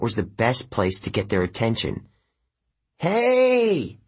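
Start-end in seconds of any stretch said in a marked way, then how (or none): 3.0-4.0 underwater shout. Acted. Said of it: "I shouted in my best underwater voice".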